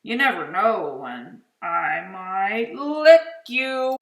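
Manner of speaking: loud hammed-up menace; mock terrifying. Monster Mac trying to scare the boy, loud hammed-up menace. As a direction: try hard to sound scary. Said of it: "trying to sound terrifying".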